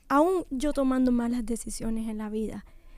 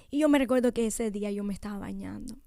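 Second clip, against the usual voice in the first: usual speaking voice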